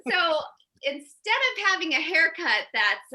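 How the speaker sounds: ordinary talk, nothing marked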